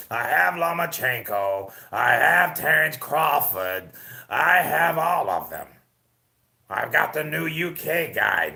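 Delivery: put-on slow, drawn-out boasting old promoter; Bob Arum voiceover. Streamer's Bob Arum voiceover for the chat, slow gloating. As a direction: savor each name like a proud old boss. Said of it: "give us your Bob".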